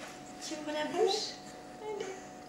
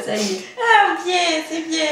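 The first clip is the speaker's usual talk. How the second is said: high, loud, laughing praise; 7 semitones higher, 13 dB louder, slower than usual. Real, not acted. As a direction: cheer her on through laughter. Baby finally swallows.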